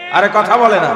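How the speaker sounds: loud rhetorical exclamation; preacher's outburst. Real, not acted. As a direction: hurl it at the crowd. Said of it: "preacher's rhetorical outburst".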